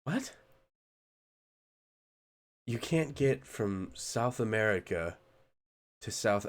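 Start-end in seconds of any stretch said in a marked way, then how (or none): 0.0-0.3 stunned what. Real, not acted. Reacting to flight path claim.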